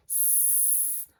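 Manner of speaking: S hissed as a bare unvoiced sss sound; isolated S sound. Shown as a demonstration. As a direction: say S as a long unvoiced hiss. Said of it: "listen for the beginning sound".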